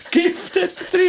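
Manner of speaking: high laughing squeal. Real, not acted. Joking poison claim, laughing.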